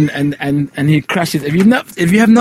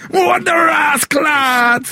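high mock shout; 13 semitones higher, much slower than usual. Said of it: "throws her through a window".

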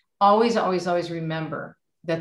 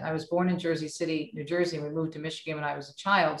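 ordinary talk

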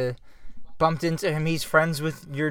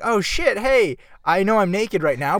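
higher, louder excited double take; mock surprise; 6 semitones higher, 4 dB louder than usual. Naked Cody spotting Murr at neighbor's table, mock surprise. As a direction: act suddenly starstruck, pitch jumping up.

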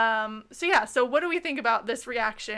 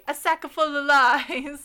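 slow, higher, louder hammed Italian accent; 4 semitones higher, 6 dB louder, slower than usual. Shown as a demonstration. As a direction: drag out sack full of lies like a stage Italian. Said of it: "so with the emoji".

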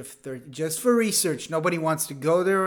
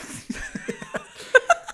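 breathless high laugh; 5 dB louder than usual. Real, not acted.

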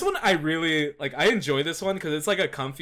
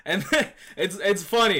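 laughing; 6 semitones higher, 5 dB louder than usual. Real, not acted. Laughing about gag anime.

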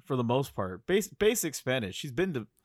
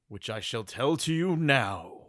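slow, grand storyteller voice; a hint of a wise old narrator; slow wise elder; 3 semitones lower than usual. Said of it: "why are you doing that voice".

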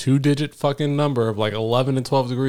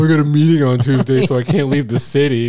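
louder, lightly put-on excuse voice; mocking homeless guy; 2 semitones higher, 7 dB louder than usual. Mocking a homeless guy who claims he's busy, in a louder, put-on voice. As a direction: whine his flimsy excuse a little louder.

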